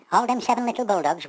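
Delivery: usual speaking voice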